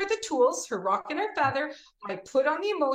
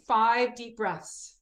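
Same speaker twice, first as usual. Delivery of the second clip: slow breathing cue; much slower than usual. Shown as a demonstration. Teacher leading children in breath work, slow. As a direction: guide the breaths slowly. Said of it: "Okay, we're gonna breathe".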